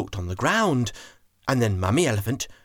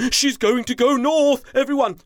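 higher, louder eager announcement; excited chief elephant; 12 semitones higher, 4 dB louder than usual. Acted. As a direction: announce it with delight. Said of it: "He became very excited".

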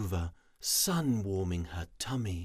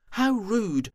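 high, loud indignant cry; indignant squeal; 13 semitones higher, 6 dB louder than usual. Acted. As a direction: squeal it out, offended. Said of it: "they declared".

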